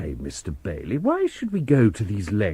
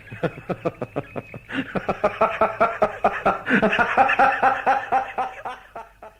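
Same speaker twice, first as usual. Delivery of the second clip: a big laugh from the belly that rolls on; the commissioner roaring with laughter at Bailey; long hearty laugh; 10 semitones higher than usual. Acted. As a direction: laugh hard and long at his expense. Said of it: "I haven't heard Enderby laugh like that for years".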